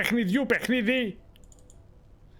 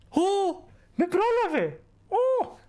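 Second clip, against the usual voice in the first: surprised; 13 semitones higher, slower than usual. Real, not acted. Reacting to a stream alert.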